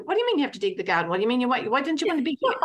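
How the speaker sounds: rapid-fire mock interrogation; 畳みかけるように早口で問い詰める; interrogating. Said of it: "I get interrogated".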